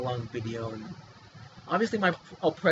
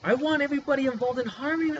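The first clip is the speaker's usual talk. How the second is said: heated; 8 semitones higher, faster than usual. Real, not acted. Heated rant about his torture.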